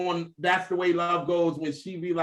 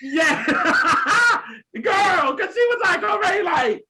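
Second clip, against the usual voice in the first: laughing; 9 semitones higher, 4 dB louder than usual. Real, not acted. Laughing at mother's reaction.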